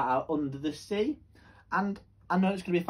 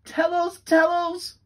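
high, excited little fish pleading; eager begging; 12 semitones higher, 10 dB louder, slower than usual. Acted. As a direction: bounce with eagerness to hear.

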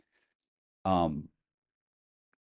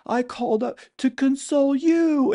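very high, loud imitation of sobbing; mock crying wail; 9 dB louder than usual. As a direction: wail it out, hammed-up, like someone crying harder. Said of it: "she starts crying even harder".

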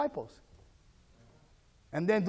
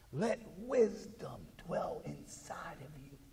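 hushed; 3 semitones lower, 15 dB quieter, slower than usual. Real, not acted.

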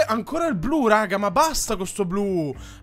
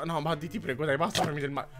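soft pleading; 7 semitones lower, 11 dB quieter, faster than usual. Real, not acted. Pleading with game character.

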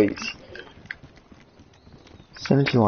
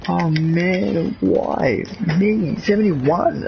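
normal talking voice

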